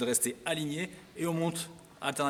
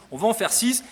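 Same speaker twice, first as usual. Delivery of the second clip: loud, higher, determined "On va en faire six"; emphatic, raised "On va en faire six"; firm self-correction; 6 semitones higher, 8 dB louder than usual. Real, not acted. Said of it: "On va pas essayer".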